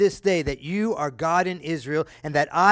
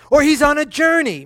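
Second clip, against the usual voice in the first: loud, higher jeering call; mocking taunt; 9 semitones higher, 7 dB louder than usual. Elijah taunting the prophets of Baal, loud and mocking. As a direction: goad them to shout louder. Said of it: "Elijah mocked them".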